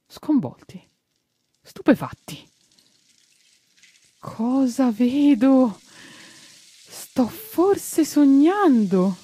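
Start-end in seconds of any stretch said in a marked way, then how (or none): 4.2-9.2 voce alta e lenta, incredula; stupore. A narrator's reading. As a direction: come chi non crede ai propri occhi. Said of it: "E Mustacchio così si stropicciò". (il giardiniere sbalordito davanti ai fiori, con stupore)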